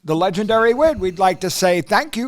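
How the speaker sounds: lively announcer outro; host sign-off. Acted. Co-host signing off the podcast to listeners, announcer style. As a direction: wrap up the show like a host.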